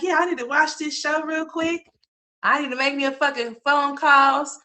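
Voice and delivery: high, wired and buzzing after a night shift; crunk and hyped. Retold or imitated. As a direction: bounce off the walls, way up in pitch. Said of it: "you be all crunk and shit".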